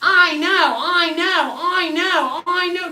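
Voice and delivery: high, slow sing-song mockery; mocking a naive follower in a high whine; mocking whiny voice. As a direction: parrot the know-it-all in a high whine. Mocking repeated whiny agreement.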